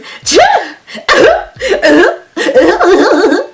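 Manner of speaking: high, wailing imitation noise; shrieky imitated laugh-like cries; mimicked noise from next door. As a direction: mimic her high carrying outburst in repeated bursts. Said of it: "all I hear is".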